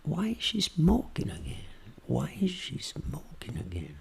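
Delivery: slow, very soft voice; hushed and slow, talking to yourself; intimate voice. Shown as a demonstration. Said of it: "Continua com a voz íntima". (voz íntima, lenta e bem baixinha)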